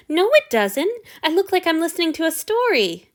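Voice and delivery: put-on high, lively boyish retort; cheeky Hanyu. A narrator's reading. Hanyu contradicting Antony, high and playful. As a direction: tease back brightly.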